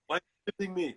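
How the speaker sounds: thick Austrian action-star complaint; offended muscleman impression; Arnold Schwarzenegger. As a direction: sound wounded in a heavy Austrian accent. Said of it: "then Schwarzenegger pops up".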